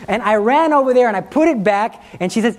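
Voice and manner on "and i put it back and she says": ordinary delivery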